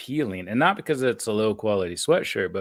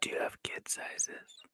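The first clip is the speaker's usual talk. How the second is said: whispering; 15 dB quieter than usual. Real, not acted. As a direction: whisper it like a secret. Jokey whispered aside.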